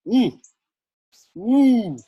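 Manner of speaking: high, loud mournful ooh; a hammed-up sad wail; mock mournful wail. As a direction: wail in sympathy for the boy. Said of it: "That's sad".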